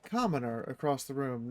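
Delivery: usual speaking voice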